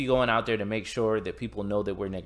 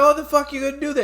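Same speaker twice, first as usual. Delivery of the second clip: much higher, louder, fast, strained; mock panicked thug; 6 dB louder, faster than usual. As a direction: blurt it out in a shrill rush. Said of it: "He's like".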